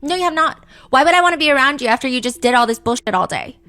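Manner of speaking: loud, fast, high-pitched angry retort; heated yell at her boyfriend; angry girlfriend. Acted. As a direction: snap back at him, raising the voice. Girlfriend yelling in the text fight.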